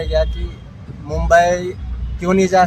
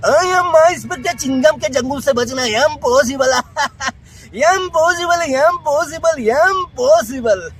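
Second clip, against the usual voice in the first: high, slowing cartoon villain voice; cartoon character voice; 8 semitones higher than usual. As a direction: stretch each impossible like a cartoon villain. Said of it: "Motu Patlu ... झलकियां".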